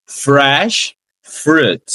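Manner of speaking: "fresh fruit" very slow with the R curled back; curled English R. Shown as a demonstration. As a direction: stretch "fresh fruit", tongue tip curled for the R. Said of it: "dobrinha do R na língua". ("fresh fruit" com a dobrinha do R)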